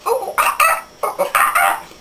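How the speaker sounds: high ooh-ooh ah-ah bursts; monkey hoots. Pretend. Playing animal noises as a monkey, hooting. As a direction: hoot and chatter like a monkey. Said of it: "I am a monkey".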